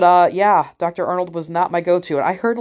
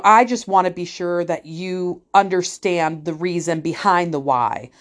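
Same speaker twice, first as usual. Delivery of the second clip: condescendingly slow, spelled-out delivery; slow it right down as if explaining to someone stupid; drawn-out, patronizing explanation; slowed-down speech; slower than usual. Retold or imitated. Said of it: "he kinda slowed down his speech".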